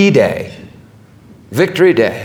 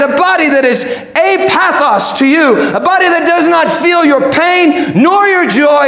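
high, impassioned answer; crying out; 13 semitones higher than usual. Acted. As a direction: cry it out with a preacher's fervour. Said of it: "And Vince cries".

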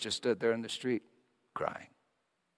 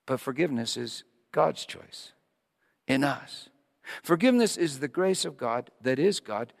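ordinary talk

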